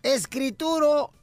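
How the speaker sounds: put-on slow, drawn-out drunken coaxing; wheedling drunk. Drunk husband bargaining to get inside, slow wheedling. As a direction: coax slowly like a drunk husband. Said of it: "el borracho dice".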